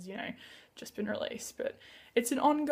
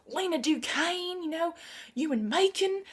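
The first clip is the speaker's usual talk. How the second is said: put-on higher-pitched drawn-out Southern US accent; imitated Southern accent, pitched up; 6 semitones higher than usual. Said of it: "with this southern accent".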